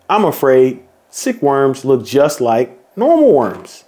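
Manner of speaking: ordinary delivery, nothing marked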